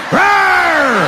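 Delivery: loud high roar with a laugh; mock roar. Intruder springs up and roars at the homeowner, loud. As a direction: roar like a raging meth head. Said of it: "He pops right back up unscathed".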